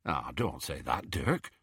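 low, soft murmured plea. A narrator's reading. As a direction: murmur it low under your voice. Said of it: "murmured Ted".

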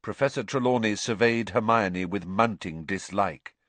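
ordinary delivery, nothing marked

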